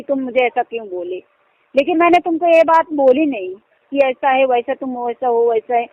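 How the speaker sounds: usual speaking voice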